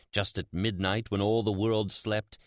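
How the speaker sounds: usual speaking voice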